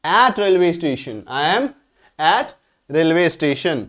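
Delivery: the English sentence spelled out very slowly; slow teacher dictation. Shown as a demonstration. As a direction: drag each word for the class to copy. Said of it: "मैं railway station पर हूँ".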